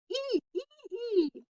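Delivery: put-on high thin baby squeals; baby shriek. A narrator's reading. Baby Tootsie shrieking amid the breakfast chaos. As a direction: squeal like a toddler. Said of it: "Tootsie shrieked".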